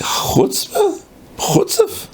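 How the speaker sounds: high, strained exclamation of disbelief; mock-outraged, drawn out and incredulous; indignant outrage. Local rav hears the newcomer answers all the questions, indignant.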